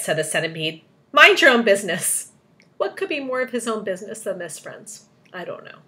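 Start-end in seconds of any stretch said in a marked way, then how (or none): 1.1-2.3 loud, raised, cross rebuke; scolding. A narrator's reading. Centipede to the earthworm, scolding. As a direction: tell them off firmly.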